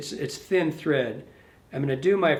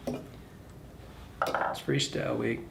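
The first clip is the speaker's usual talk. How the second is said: slow, softer whispered aside; 4 semitones lower, 10 dB quieter, much slower than usual. Real, not acted. Tying a fly, whispering an aside to himself. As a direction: let it slip out under your breath.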